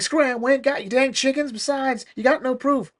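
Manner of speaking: high, squawky yokel threat; hammed-up hillbilly snarl; gruff homesteader. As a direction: shoo them off like a cranky farmer. Hostile homesteader shooing ducks.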